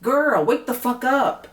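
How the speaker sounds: stretched scolding cry at A D; exasperated scold. Real, not acted. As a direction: drag each word out to shake her awake.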